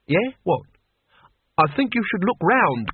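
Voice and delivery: ordinary delivery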